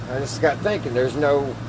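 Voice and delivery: ordinary talk, nothing marked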